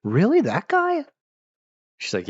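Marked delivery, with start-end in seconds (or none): 0.0-1.0 shocked disbelief. Retold or imitated. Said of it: "people are like".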